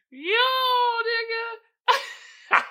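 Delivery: high words through laughter; laughing outcry. Real, not acted. Host squealing through laughter at the meme. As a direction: squeal it while still laughing.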